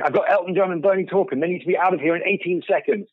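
playful imitation of a frantic publicist. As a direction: rush in like a stressed publicist. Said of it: "another publicist comes running in going".

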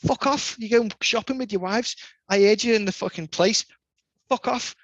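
cocky telling-off. Retold or imitated. Narrator kicking skaters out at the station, gleeful telling-off. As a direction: relish sending them packing.

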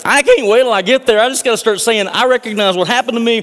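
high, loud, eager voice; can't wait to get there; a healed leper hurrying back, high and loud; excited rush. Acted. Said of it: "He's like".

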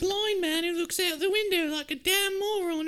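very high, loud put-on accent; hammed-up screechy rant; mock mouthy girl. Girl tells knight about the blind man, comic screeching.

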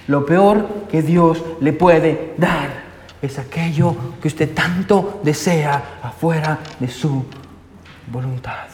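slow, deliberate restatement; slow preaching emphasis. Acted. Preacher dictates the key verse slowly for note-taking, deliberate.

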